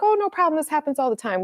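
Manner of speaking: high-pitched imitation of a helpful stranger; breezy reassurance. Helpful stranger to woman who lost her keys, breezily reassuring. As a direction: toss it off very high, then ease down.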